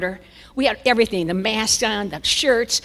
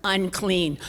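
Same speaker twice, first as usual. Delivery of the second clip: normal talking voice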